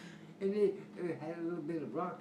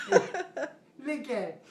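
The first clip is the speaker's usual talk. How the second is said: slow, louder, laughing look at it; laughing scold; 8 semitones higher, 10 dB louder, much slower than usual. Retold or imitated. Older brother scolding the younger one, slow and laughing. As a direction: drag it out while laughing at him. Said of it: "scolding the brother about the cow".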